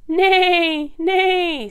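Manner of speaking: high, loud imitated whinny; horse neigh. A narrator's reading. Storyteller to children as the horse by the farmyard, loud high neighing. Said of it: "said the horse".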